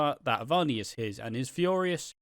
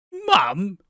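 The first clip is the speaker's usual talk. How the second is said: mock shock; 8 semitones higher, 9 dB louder than usual. Nugget learns about his mother.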